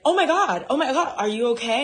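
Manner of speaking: alarmed concern. Retold or imitated. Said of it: "and I'm like".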